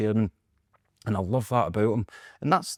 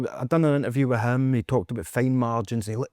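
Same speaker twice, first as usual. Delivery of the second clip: ordinary talk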